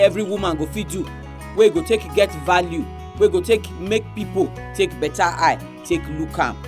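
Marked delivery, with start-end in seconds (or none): none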